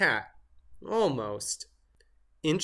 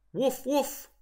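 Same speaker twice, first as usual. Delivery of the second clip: dog barking; 10 semitones higher than usual. Pretend. Turkey pretends to be dog.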